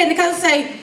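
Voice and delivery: ordinary delivery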